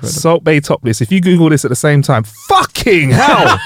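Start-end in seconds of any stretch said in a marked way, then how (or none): 2.2-3.6 slow, drawn-out amazed swearing; real astonishment. Real, not acted. Host seeing the topless photo, drawn-out astonishment. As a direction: stretch each word in amazement.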